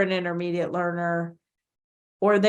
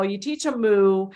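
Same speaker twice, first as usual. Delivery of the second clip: usual speaking voice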